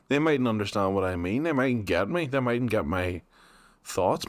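ordinary talk

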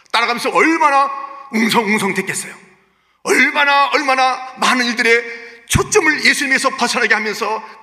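loud, high, impassioned sermon delivery; fervent pulpit voice; full-force preaching. Acted. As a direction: preach it hard to the congregation.

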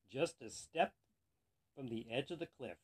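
ordinary delivery

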